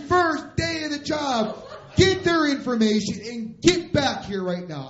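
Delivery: high, loud angry tirade; screaming boss. Retold or imitated. Boss on radio to crashed driver, furious screaming. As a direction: imitate a boss screaming as if scolding a kid. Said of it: "He's screaming at me like I'm a kid".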